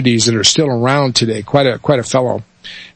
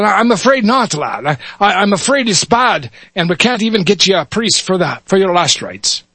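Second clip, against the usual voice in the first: sorrowful higher Irish brogue; Irish cop; 6 semitones higher than usual. Acted. Officer Muldoon tells the lad no priest came, Irish accent. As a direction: apologize gently in an Irish lilt. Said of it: "switch between dialects here".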